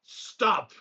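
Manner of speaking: high, sharp order to halt; commanding cry. Acted. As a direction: call out a magical command. Player casts a command, in character.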